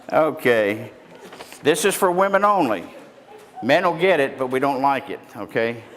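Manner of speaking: ordinary talk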